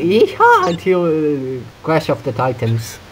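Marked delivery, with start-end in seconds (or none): 0.0-0.7 high yeehaw cry; yeehaw whoop. Shown as a demonstration. Gamer showing the one thing they can say, high whoop. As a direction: break into a high, short whoop. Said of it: "I can only say".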